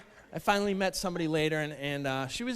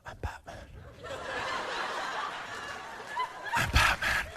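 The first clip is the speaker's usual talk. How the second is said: Batman voice; 8 dB quieter than usual. Pretend. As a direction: growl it like the Caped Crusader. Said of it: "I'll just look in the mirror and I'll say".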